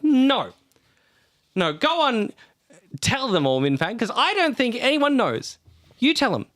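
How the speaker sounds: high, forceful demand; angry demand. Acted. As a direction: push him to confess. Yanni's angry confrontation.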